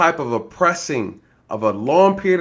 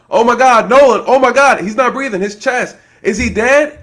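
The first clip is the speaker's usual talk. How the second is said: high, loud frantic alarm; panicked cries; 7 semitones higher, 5 dB louder than usual. Retold or imitated. Passengers at the back of the boat panicking, loud frantic cries. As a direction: cry out in panic over the body. People panicking over the body.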